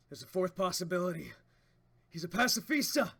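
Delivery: loud high realization; alarmed exclamation. Acted. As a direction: announce the danger. Voices manga character reveal.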